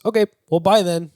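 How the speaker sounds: high, loud imitated send-off; mock breezy dismissal. Acts out the lady dismissing him.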